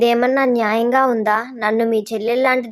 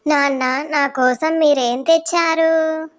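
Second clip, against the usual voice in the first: put-on child voice; 4 semitones higher, slower than usual. Son asks father for treats.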